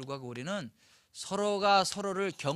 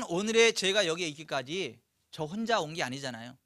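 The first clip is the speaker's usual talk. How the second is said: ordinary talk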